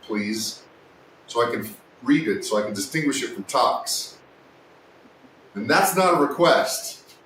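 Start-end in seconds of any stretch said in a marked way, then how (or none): 5.5-7.1 louder, half-joking command; firm yell. Real, not acted. Streamer mock-ordering a viewer to change text colour, loud. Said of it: "Sorry if I'm yelling".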